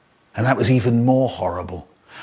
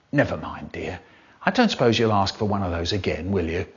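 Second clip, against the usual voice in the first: gentle mum; 5 semitones lower, 4 dB quieter than usual. Acted. Mum gently consoling her son.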